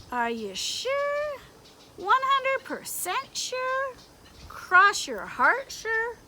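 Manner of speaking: slow, high, teasing doubt; probing conscience. Acted. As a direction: drag out each question to make him squirm.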